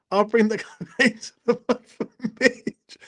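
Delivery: real giggles breaking into the line; laughing. Real, not acted. Host laughing as he reads a comment to Mitch.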